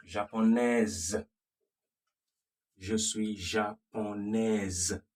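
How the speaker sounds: japonaise said slowly, the S sounded like a Z; slow down and buzz the S of japonaise like a Z. Shown as a demonstration. Teacher slowly contrasting japonaise with japonais. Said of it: "the female would be".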